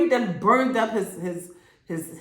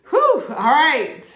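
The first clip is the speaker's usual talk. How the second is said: relieved whew; 8 semitones higher, 7 dB louder, slower than usual. Real, not acted. Reaction after dramatic ending.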